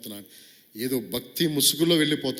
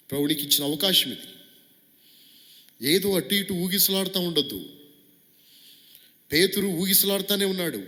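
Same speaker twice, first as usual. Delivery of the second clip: raised, urgent pulpit voice; high, louder preaching cry; preaching at full force; 8 semitones higher, 4 dB louder than usual. Acted. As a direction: drive the appeal home from the pulpit. Urgent appeal on wavering Peter.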